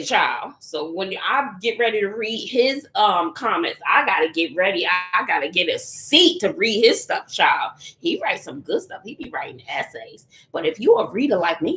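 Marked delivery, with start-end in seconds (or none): none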